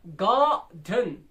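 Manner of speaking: Garden slowly, loud long गार then light डन; garden split, falling away on डन; strong-weak stress. Shown as a demonstration. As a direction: stretch and punch गार in garden. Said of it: "पहला strong हो रहा है".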